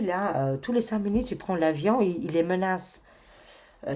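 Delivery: ordinary talk, nothing marked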